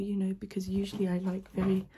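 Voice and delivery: ordinary talk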